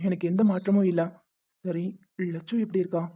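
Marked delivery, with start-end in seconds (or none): 1.6-3.0 quiet, subdued request; soft plea. Acted. Father asking to see his daughter, soft and hesitant. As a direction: ask gently, almost afraid to ask.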